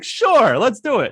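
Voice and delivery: mock eager agreement. Co-host replaying his own quick yes, high and mocking. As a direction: chirp the yes like an eager kid.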